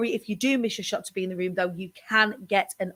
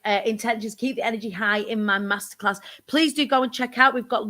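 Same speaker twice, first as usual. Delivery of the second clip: ordinary delivery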